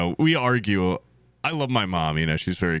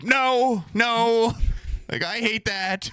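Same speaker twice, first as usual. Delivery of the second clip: mocking mom's refusal; 12 semitones higher, much slower than usual. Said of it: "She's like".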